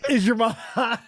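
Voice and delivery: laughing through the repeated question; cracking up while quoting the caller. Retold or imitated. Host cracks up repeating the caller's question, laughing. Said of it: "It's amazing".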